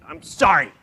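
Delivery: put-on loud, grudging child's sorry; insincere apology. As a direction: blurt a sorry you don't mean. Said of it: "does she sound sincerely sorry?".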